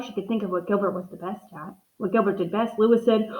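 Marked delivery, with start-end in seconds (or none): none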